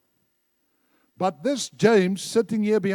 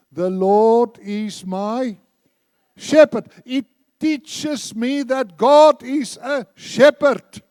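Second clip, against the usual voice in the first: proclaimed; 5 semitones higher, 10 dB louder, slower than usual. Real, not acted. Preacher proclaims the verse.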